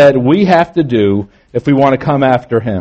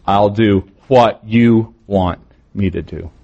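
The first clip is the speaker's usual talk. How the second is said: slow, lower line with YOU set apart and weighted; stress on YOU; 4 semitones lower, slower than usual. Shown as a demonstration. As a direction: dwell on you before want. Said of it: "that's where the emphasis becomes".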